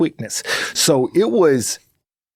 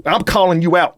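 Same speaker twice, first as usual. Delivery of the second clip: normal talking voice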